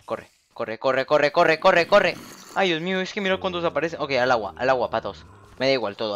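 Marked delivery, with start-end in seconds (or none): 0.1-4.0 building from plain to loud high panic; panicked. Real, not acted. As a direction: urge yourself to run, rising. Running from mobs, rising panic.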